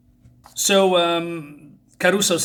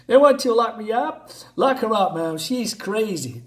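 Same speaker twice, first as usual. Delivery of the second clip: higher agitated rant, up in her face; angry husband; 7 semitones higher than usual. Acted. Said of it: "he gets up in her face".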